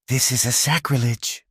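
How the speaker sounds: lightly voiced old Italian priest, hushed; Italian accent. A narrator's reading. Frightened priest protesting to Luca in an Italian accent. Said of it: "whispered in a thick Italian accent".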